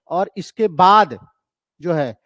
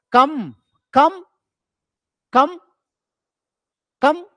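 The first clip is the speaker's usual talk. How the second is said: "कम" slow, louder and higher, drawn out; stressed syllable demo; 6 semitones higher, 5 dB louder, much slower than usual. Shown as a demonstration. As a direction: hit "कम" hard and slow, like a teacher. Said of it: "प्यू को बहुत simple से बोलते हैं कम". ("कम" पर ज़ोर, धीमे और ऊँचे)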